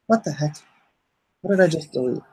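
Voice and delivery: ordinary delivery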